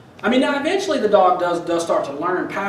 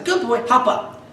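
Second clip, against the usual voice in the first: encouraging dog command; 6 semitones higher than usual. Retold or imitated. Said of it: "and then I say".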